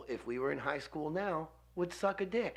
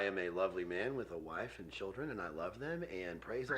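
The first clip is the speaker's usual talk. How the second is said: low, hushed imitation of a pious man; mock dark piety; 9 semitones lower, 9 dB quieter than usual. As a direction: drop low and soft, mockingly solemn. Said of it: "I could hear it going dark, and then you were like".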